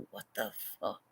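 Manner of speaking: dropped, whispered what the fuck; low whispered disbelief. Retold or imitated. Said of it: "I'm like".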